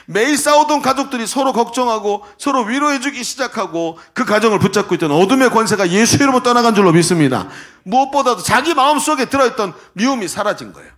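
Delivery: loud, high declaration from the pulpit; swelling, triumphant sermon climax; full-force preaching. Acted. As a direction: proclaim it forcefully to the congregation.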